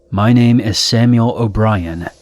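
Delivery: ordinary delivery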